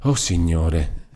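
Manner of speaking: slow, soft lament; drawn-out, grieving invocation; sorrowful exclamation. A narrator's reading. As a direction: sigh a pained prayer to heaven. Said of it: "esclamò dolorosamente Lucia".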